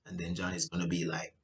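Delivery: ordinary delivery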